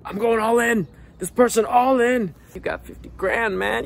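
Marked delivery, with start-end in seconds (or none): 0.1-2.3 high eager voice; eager quote. Retold or imitated. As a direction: play the over-keen dater. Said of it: "You've essentially just said".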